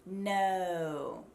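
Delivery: drawn-out "No" with tongue pulling back; "No" sliding back into the mouth; American O. Shown as a demonstration. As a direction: stretch "No" with a US American O. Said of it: "the tongue actually pulls back".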